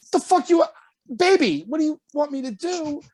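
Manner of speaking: high-pitched, incredulous what-do-you-want; exasperated outburst. Retold or imitated. To the cars piling up behind him, high exasperated plea. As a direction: throw up your hands at the cars behind.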